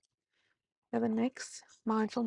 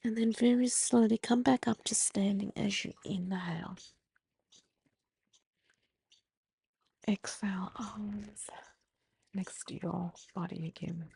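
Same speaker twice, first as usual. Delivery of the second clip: gentle yoga instructor voice; guided breathing cues; 5 dB louder than usual. Acted. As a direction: talk them calmly through each breath. Leads the forward fold.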